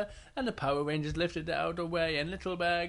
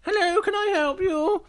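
high, louder shopkeeper voice; a sing-song shop lady greeting; 6 dB louder than usual. Acted.

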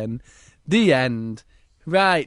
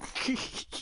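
put-on hushed, hissy children's snigger; stifled giggly snickering; mock sniggering; 11 dB quieter than usual. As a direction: snigger under your breath like naughty pupils. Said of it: "all the children start sniggering".

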